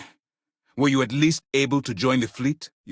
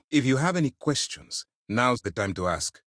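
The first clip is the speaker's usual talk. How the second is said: ordinary delivery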